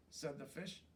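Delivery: usual speaking voice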